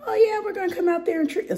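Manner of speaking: high, rushed, strained pitch to customers that breaks up; voice giving out. Shown as a demonstration. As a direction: voice cracks into a high squeak. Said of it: "I just lost my voice again".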